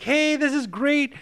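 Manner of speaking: high, slow excited wife; ecstatic wife. Retold or imitated. Wife thrilled about moving, high excited voice. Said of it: "the wife immediately gets ecstatic".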